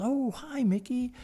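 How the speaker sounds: high, soft feminine hello; lilting, gently surprised hello; imitated sweet greeting. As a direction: play a wife greeting an old acquaintance. Voices wife's sweet greeting.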